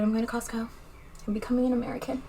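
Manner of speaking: a playful tongue-in-cheek boast; joking. Pretend. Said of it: "It's a joke".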